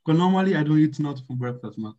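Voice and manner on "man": usual speaking voice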